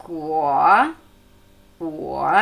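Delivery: Guo low, squatting and unhurried; leisurely dipping tone on Guo; low third tone. Shown as a demonstration. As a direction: sink down on Guo without rushing. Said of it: "Dritte hockende, gemächliche Ton".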